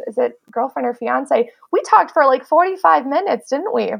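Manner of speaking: ordinary talk, nothing marked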